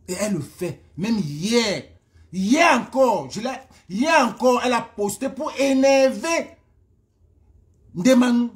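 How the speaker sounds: loud, high-pitched, drawn-out emphasis; véhémence agacée. Real, not acted. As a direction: hammer it out in exasperation. Insiste, agacée, sur la provocation. (véhément et agacé)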